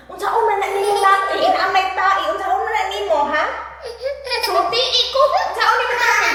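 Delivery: high, loud scolding questions; exclaiming questioning. Real, not acted. Questioning child about dirty nappy. (kusog ug taas nga pangutana)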